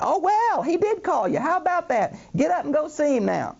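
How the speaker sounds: very high, hammed-up surprise; mock enthusiasm. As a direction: put on an exaggerated high voice of a fickle crowd. Mocking the crowd's sudden change.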